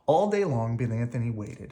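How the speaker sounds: ordinary delivery, nothing marked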